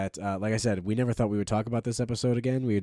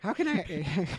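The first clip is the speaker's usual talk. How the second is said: cat voice; 9 semitones higher, slower than usual. Pretend. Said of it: "Those are our cat voices".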